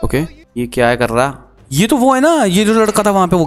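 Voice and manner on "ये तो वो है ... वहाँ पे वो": surprised. Real, not acted. Recognizing the boy in game.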